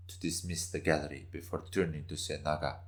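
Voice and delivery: ordinary delivery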